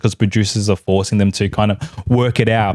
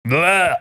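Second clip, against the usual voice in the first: higher-pitched mock retch; disgusted bleh; 6 semitones higher than usual. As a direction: blurt a bleh of playful disgust. Disgusted noise as one-word verdict.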